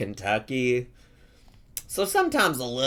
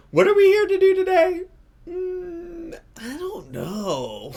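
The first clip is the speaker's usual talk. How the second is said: put-on whiny baby voice; 4 dB louder than usual.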